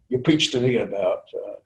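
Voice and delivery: ordinary talk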